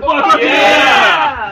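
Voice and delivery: high, loud mock cheer; shout. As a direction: yell it like a stadium crowd. Joining patriotic cheer.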